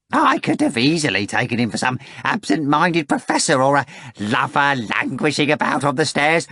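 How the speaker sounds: a fussy jester confiding suspicions; Korofiov's fawning voice. Acted.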